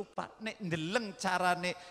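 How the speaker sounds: ordinary talk, nothing marked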